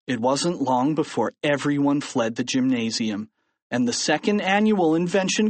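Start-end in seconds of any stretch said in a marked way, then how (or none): none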